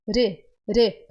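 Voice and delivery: re with the tongue tapping the palate; tapped R. Shown as a demonstration. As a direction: tap the r in re quickly. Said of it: "a sua língua ela vai subir".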